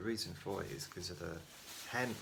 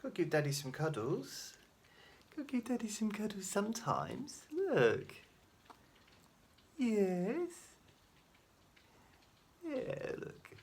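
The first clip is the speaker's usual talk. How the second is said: put-on baby talk; 13 semitones higher, slower than usual. Cooing baby talk to pet.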